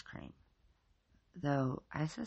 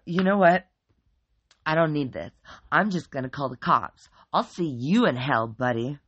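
loud macho bro voice; loud bro threat; tough guy threat; 3 semitones higher, 11 dB louder than usual. A narrator's reading. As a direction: square up and threaten him. Mike backs off blustering.

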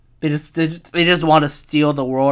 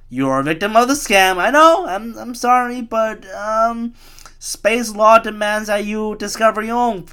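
high-pitched, raised voice of a sorry official; mock-apologetic officer; 9 semitones higher, 5 dB louder than usual. Space police apologizing to scam victims, high mock-apologetic voice. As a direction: plead the rules in a high put-on voice.

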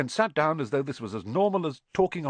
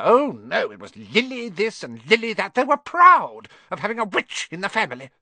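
high, bitter tirade building louder; ranting aunt; 6 semitones higher, 4 dB louder than usual. A narrator's reading. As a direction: spit out years of resentment. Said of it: "then went ranting on".

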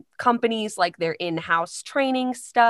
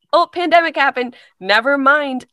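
higher, louder, quick dismissal; abrupt mock letdown; 7 semitones higher, 8 dB louder than usual. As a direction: toss out the bad news in mock brightness. Mimics hopes being suddenly cancelled.

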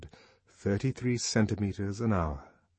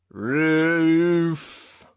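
high, loud, drawn-out cry; creaking call; 8 semitones higher, 7 dB louder than usual. A narrator's reading. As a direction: creak the word out long. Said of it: "called a creaking voice".

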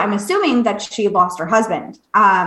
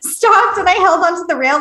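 excited; 8 semitones higher, 3 dB louder than usual. Real, not acted. Retelling seeing a ghost.

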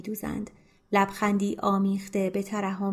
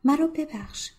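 repeated; 7 semitones higher than usual. Acted. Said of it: "تکرار کرد".

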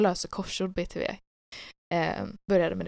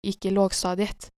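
ordinary talk

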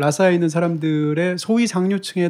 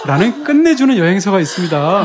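louder, breaking into laughter; laughing plug; 3 semitones higher, 4 dB louder than usual. Real, not acted. Author plugging his own travel book, laughing. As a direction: plug it through your laughter.

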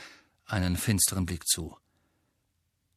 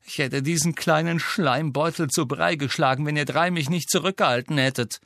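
higher, faster, a vicious threat; spiteful, fast rant; nasty threat; 6 semitones higher, 4 dB louder than usual. Acted. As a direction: spit out what he would have done. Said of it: "mit einem sehr hässlichen Gesichtsausdruck".

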